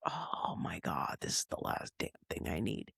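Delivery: hushed, voiceless groaning complaint; exasperated whisper. Retold or imitated. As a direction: whisper it like you can't believe your luck. Retelling muttered dismay at broken computer.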